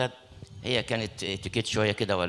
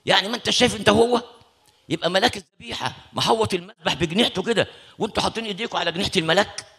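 higher and louder stern rebuke; scolding shout; 8 semitones higher, 4 dB louder than usual. Retold or imitated. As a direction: scold them for touching the angel. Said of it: "راح شخط فينا تالت". (زجر عالٍ غاضب)

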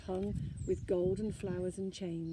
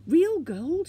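high, loud, astonished question; breathed amazement; 9 semitones higher, 11 dB louder than usual. A narrator's reading. Snork Maiden seeing a pile of gold, amazed. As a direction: marvel at it, voice leaping up. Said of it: "she breathed".

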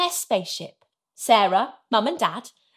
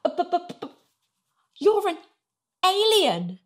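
high, startled stutter; halting, taken aback; 6 semitones higher than usual. A narrator's reading. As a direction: stammer it out, then land high on the last word. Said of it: "stuttered Sarah".

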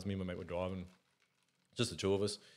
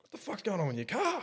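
baffled driver; 11 semitones higher than usual. Retold or imitated. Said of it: "He's like".

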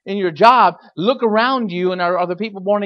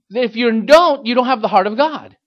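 loud preaching; 5 semitones higher, 6 dB louder than usual. Shown as a demonstration. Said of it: "Let me say it again".

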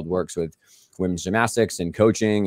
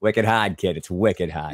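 put-on Boston accent; slower than usual. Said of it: "faking a Boston accent more heavily".

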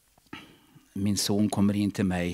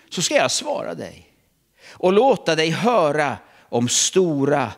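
high, loud, slow proclamation; booming voice of God; 4 semitones higher, 6 dB louder, slower than usual. Acted. Preacher booming God's promise to the church, loud and slow. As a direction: thunder God's promise out over the hall.

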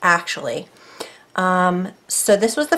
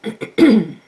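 usual speaking voice

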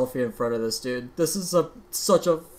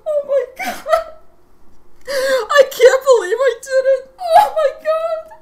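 real happy sobbing, much higher and cracking; crying with joy; 3 dB louder than usual. Real, not acted. Gamer after his first ever full combo, sobbing with joy. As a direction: weep with joy, laughing through the tears. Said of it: "there are tears coming out of my fu".

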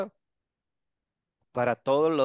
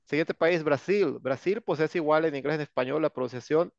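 normal talking voice

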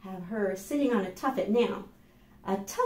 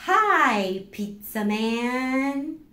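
eager greeting; 6 semitones higher, 6 dB louder, much slower than usual. Acted. Child's answer, loud greeting.

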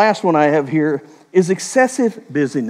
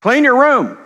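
put-on loud high command to a kid; parent's shout; 10 semitones higher, 6 dB louder than usual. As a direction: bark the chore order. Parent calling to child.

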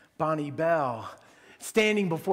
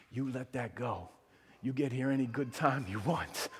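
gentle reassurance; 6 semitones lower, 6 dB quieter than usual. Retold or imitated. Media guy comforting Jill privately.

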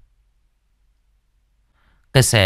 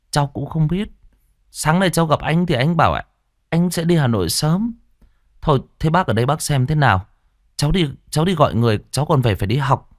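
lightly voiced boy; 7 semitones higher than usual. Narrator voicing young boy.

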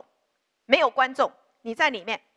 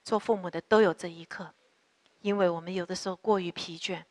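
lower, earnest prayer voice addressing God; 4 semitones lower than usual. Acted. Pastor leading congregation in prayer for parents, low earnest prayer. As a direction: pray steadily, a touch lower than the sermon.

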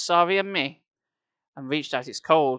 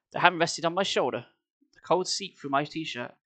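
ordinary delivery, nothing marked

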